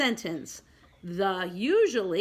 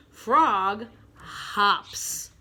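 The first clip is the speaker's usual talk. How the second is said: drawn-out title reading; slow read-along; 3 dB louder than usual. Shown as a demonstration. Teacher leads pre-K pupils reading the title, slowly. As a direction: stretch both words for beginners to follow.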